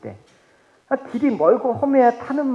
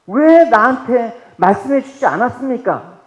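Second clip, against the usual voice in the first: aggrieved complaint; 5 semitones higher, 8 dB louder than usual. Acted. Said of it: "이런 사람들이 없을까요".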